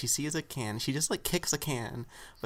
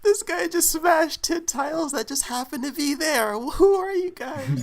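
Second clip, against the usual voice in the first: high, loud imitation of a stunned guy; mock flabbergasted panic; 12 semitones higher, 7 dB louder than usual. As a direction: squawk in disbelief, then rush off the last words. Acted quote of freaked-out bystander.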